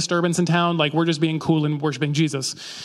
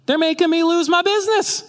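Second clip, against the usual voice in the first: much higher, strained complaining merchant voice; whiny merchant; 11 semitones higher, 3 dB louder than usual. Retold or imitated. Preacher mimicking the silversmith's greedy complaint, high and whiny. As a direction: whine about lost profits in a pinched, high voice. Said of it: "His complaint is".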